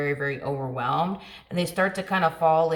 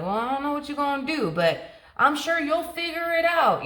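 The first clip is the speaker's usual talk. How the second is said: high-pitched, put-on unconcern; airy, exaggerated dismissal; mock breezy brush-off; 10 semitones higher than usual. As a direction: wave off their problem in a high voice. Acts out brushing off a plea.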